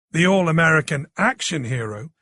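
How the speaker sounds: plain reading voice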